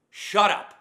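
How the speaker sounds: huge, high, full-throated yell of shut up; full-force shout. Real, not acted. Ranting at complaining Marines, screaming at full force. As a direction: scream it with everything you've got. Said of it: "God, that felt so good".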